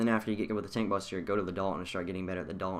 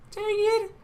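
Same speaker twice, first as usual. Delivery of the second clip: much higher and louder yelp; high frustrated yelp; 10 dB louder than usual. Real, not acted. Gamer losing a fight, a high loud yelp. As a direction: squeal it in frustration.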